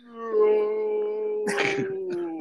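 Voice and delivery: long, high drawn-out wail; mock slow-motion howl held on one note; slow-motion cry. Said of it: "in slow motion".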